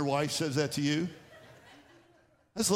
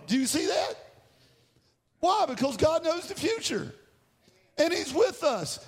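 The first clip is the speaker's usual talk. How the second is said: higher, building preacher's voice; preaching; 6 semitones higher than usual. Real, not acted. Pastor urges the congregation that God is with them, preaching. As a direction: preach with rising conviction.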